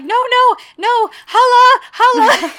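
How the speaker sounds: hammed-up shriek calling the aunt; screaming for her aunt. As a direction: wail for help like a spoiled diva, half laughing. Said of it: "She starts screaming for her aunt".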